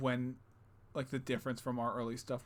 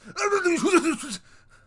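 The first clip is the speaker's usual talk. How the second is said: loud laugh; 11 dB louder than usual. Real, not acted. Laughing after clown voice.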